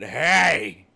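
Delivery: sudden obnoxious shout; jackass tourist. Acted. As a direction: holler it like a rude tourist. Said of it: "not a jackass".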